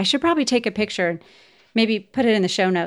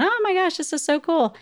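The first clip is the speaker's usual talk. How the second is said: high-pitched gush of mock kid excitement; imitated excited kids; 10 semitones higher than usual. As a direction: mimic thrilled kids running round a new house. Kids running around new house.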